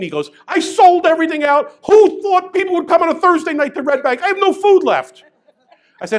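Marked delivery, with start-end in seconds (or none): 0.5-5.2 loud, high, overwhelmed rant; frantic complaint. Retold or imitated. Sold-out restaurant owner ranting to him, loud and high. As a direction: throw up your hands, can't believe the crowd.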